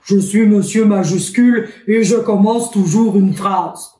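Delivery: a lightly voiced grand gentleman introducing himself; playing Monsieur Majuscule. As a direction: speak as the tall capital letter, proudly. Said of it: "C'est un vrai magicien".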